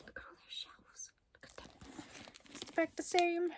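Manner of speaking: soft breathless whisper. Real, not acted. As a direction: whisper it in awe. Admiring the shelves.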